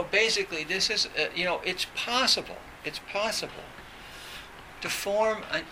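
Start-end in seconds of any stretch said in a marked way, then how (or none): none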